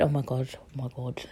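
soft, low, choked-up mumble; suddenly quiet as the feeling rises; getting soppy. Real, not acted. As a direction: drop low and soft, holding back tears. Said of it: "Bitch is getting soppy".

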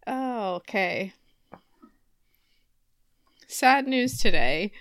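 ordinary talk

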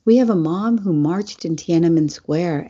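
ordinary talk, nothing marked

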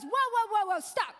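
loud, high whoa to cut her off; stopping her short. Retold or imitated. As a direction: halt the kid mid-line, half-laughing. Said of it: "And Doctor Rick goes".

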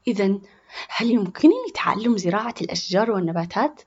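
usual speaking voice

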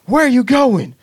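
high, loud mock outcry; retold alarm. Examiner stopping learner pulling out, mock alarmed. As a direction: imitate an alarmed driving examiner.

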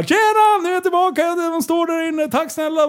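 very high-pitched mock-cheery call; acted greeting. Driver handing back borrowed gear, high acted call. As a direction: sing out a hurried thanks high up. Hurried thanks as he returns things.

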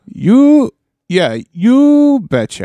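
"You" jumped high then slow falling "yeah, you betcha."; exaggerated betcha. Shown as a demonstration. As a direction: overdo the "You betcha." demo. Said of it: "Now try this one".

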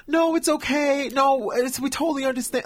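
mock-sweet and high-pitched understanding; sweet reassurance. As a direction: coo it like kindly coworkers, imitating. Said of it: "everybody's super sweet".

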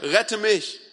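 urgent but restrained plea; narrated cry. A narrator's reading. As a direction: read it as a contained cry for help. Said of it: "schrie er".

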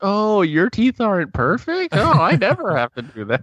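high, exaggerated snooty mimicry; mock shaming. [name] mocks a friend who pleads poverty, in a high shaming voice. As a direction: shame them in a high, put-on voice. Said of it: "I am shaming you".

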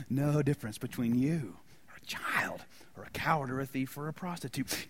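hushed whisper of the point; a secret told to the congregation. Acted. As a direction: lean in and whisper it.

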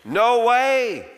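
high, loud, forceful no; John flatly refusing, loud and high; emphatic denial. Acted. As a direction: slam the door on the idea. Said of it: "He is emphatic".

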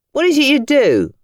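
high, loud curious cabbie; cheeky nosy driver asking. Acted. Cabbie quizzing Tom about his work, high and loud. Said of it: "the cabbie asked".

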